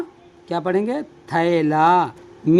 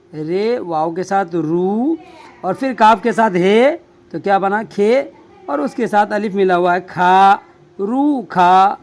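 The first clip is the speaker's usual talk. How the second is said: ordinary talk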